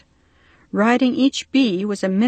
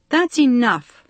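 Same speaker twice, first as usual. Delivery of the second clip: put-on sharp, louder cry to silence the girls; cried out; 5 semitones higher, 5 dB louder than usual. A narrator's reading. Loud exasperated cry to the bickering girls. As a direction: bee man losing patience, cries out to stop the twins. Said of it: "the bee man cried".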